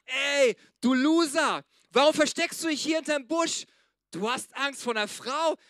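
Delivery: acted scornful telling-off at full volume; mock scolding. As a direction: berate a coward in a hammed-up shout. Acts out scolding the angel never gave.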